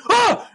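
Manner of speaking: loud, high re-enacted jump-scare gasp; startled yelp. Telling friend about getting jump scared, startled yelp. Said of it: "and I literally went".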